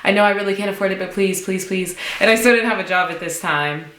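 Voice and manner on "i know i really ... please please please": high, rapid pleading; retold desperate little pleas, pitched up; begging. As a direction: beg over and over, words tumbling out. Acting out begging to get in.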